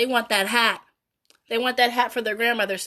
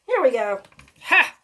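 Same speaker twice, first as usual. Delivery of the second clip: usual speaking voice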